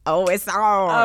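slow, teasing "game on"; playful challenge. Retold or imitated. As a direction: toss down a mock challenge. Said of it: "I'm like".

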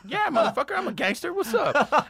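high, cocky what's up; high hammed-up LA gangster bravado; mock gangster bravado. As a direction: puff up like an LA gangster. His cocky reply to the German.

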